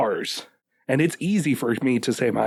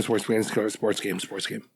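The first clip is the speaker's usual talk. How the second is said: droning mock repetition; 5 semitones lower, 6 dB quieter than usual. Mocking yearly sports games.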